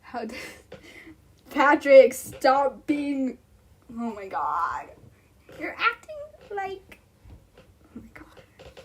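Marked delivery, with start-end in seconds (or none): 1.5-3.4 sudden loud call; shout. Real, not acted. Streamer yelling at a friend in chat, loud. 5.6-8.8 exasperated whisper. Real, not acted. Fading exasperation.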